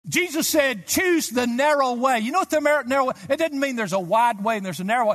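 loud, high pulpit shout, fast in places; fervent preacher exhorting the crowd; shouted preaching. Acted. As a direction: call out the command with fire. Preaching at full force.

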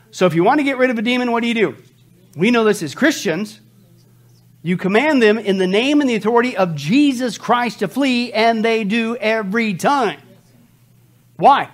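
forceful preaching. Real, not acted. Preacher tells congregation how to expel demons, preaching forcefully. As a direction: build with absolute conviction.

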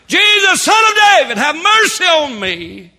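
high, full-voiced shout for mercy; crying out. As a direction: cry out desperately over a crowd, stretching the final plea. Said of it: "A blind man is crying".

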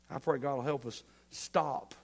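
usual speaking voice

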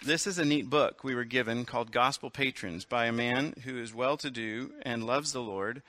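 ordinary talk

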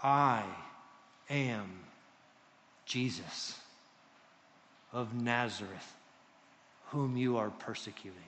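low, soft, very spaced words; voice from heaven. Retold or imitated. Jesus answers Saul from heaven, low and deliberate. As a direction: let each word land, quietly and gravely. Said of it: "What does the voice say?".

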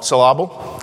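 "syllable" said si-LAH-bul; comic stress on the middle of "syllable"; misplaced stress. Shown as a demonstration. Preacher joking about emphasis, "syllable" as si-LAH-bul. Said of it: "put the emphasis on the syllable".

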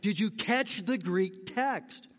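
usual speaking voice

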